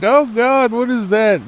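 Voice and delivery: mock freak-out. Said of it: "she freaked out".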